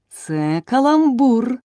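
high, loud, slow angry cry; angry shout. Acted. King angrily shouts at the silent hall. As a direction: bellow it out in irritation. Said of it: "крикнув король сердито".

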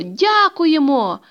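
loud, high, drawn-out call; stretched shout of thanks to someone left behind. A narrator's reading. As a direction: call back over the shoulder while running. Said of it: "гукнула".

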